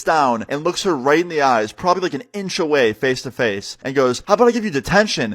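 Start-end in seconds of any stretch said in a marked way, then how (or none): 4.3-5.3 quick, high-pitched mock-sweet threat; threatening. Substitute face to face with a late student, threatening. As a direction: imitate a teacher's pointed offer, fast and higher.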